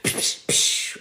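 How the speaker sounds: unvoiced psh sound effects; imitated action noises. Speaker going Charlie's Angels, imitated whooshes. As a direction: mouth the whooshes of an action move. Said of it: "I went Charlie's Angels".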